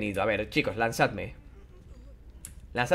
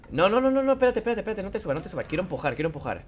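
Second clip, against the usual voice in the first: urgent protest; 4 semitones higher, 4 dB quieter, faster than usual. Real, not acted. Stopping character climbing on.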